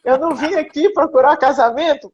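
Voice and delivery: laughing protest. Retold or imitated. Zezé refusing the priest's marriage offer, amused and emphatic.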